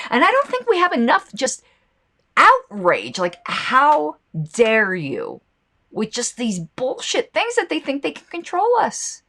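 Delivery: high-pitched fired-up outrage; worked up. Real, not acted. Ranting about government control, high worked-up outrage. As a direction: let the anger push the voice high. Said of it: "I'm getting all worked up".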